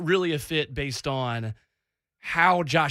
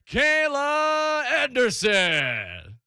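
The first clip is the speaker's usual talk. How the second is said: PA announcer voice, higher, slow; stadium announcer; 9 semitones higher, much slower than usual. Pretend. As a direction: boom it out like an arena PA. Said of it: "P A announcer voice".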